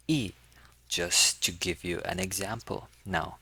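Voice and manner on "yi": yi falling sharply from high; fourth tone. Shown as a demonstration. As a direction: drop "yi" quickly from the top. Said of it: "These are the four tones".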